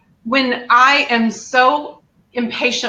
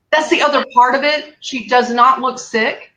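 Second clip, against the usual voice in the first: normal talking voice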